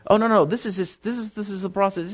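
calm a shaken new believer; encouraging guide; reassuring mentor. Acted. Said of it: "disciple me and say".

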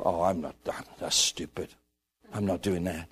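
low, soft grumble; sulky mock reluctance; dismissive refusal. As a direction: brush it off under your breath.

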